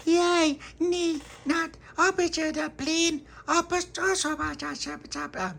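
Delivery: put-on very high, squeaky little-child babble; excited babbling. Excited toddler babble in the story.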